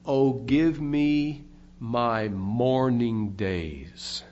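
low, very slow, solemn plea; mournful retold cry. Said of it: "He cried out".

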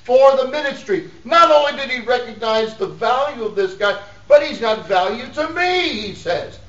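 high, loud pulpit delivery; emphatic preaching. Acted. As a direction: drive the point home to the congregation. Preaches at raised force.

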